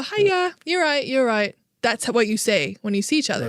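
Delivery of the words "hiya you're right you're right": high, chirpy British "hiya"; breezy British passing hello; a light British accent; British greeting. Retold or imitated. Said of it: "you just go".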